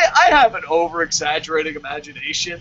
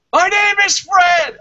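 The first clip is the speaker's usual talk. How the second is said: slow, high, loud yell; mock shout; 12 semitones higher, 6 dB louder, slower than usual. Retelling a wrestler's shouted comeback in a mock yell. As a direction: bellow the name like a madman. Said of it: "He's like".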